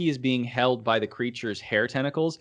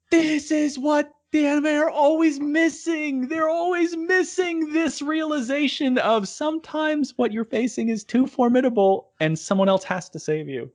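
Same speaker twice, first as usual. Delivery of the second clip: excited gushing; 12 semitones higher than usual. Retold or imitated. Fan gushing to co-host about what anime misses, excited.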